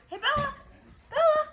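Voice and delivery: high-pitched pet-calling voice; calling the dog. Real, not acted. Owner calling to pet dog on camera, high-pitched. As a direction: coo the name up high.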